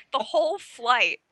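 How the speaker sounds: ordinary talk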